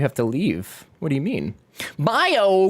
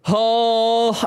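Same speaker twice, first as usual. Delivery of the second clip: ordinary delivery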